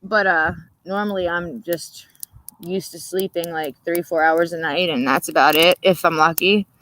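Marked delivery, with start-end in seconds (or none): none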